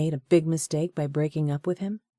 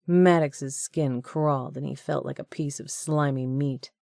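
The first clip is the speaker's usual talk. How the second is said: ordinary talk, nothing marked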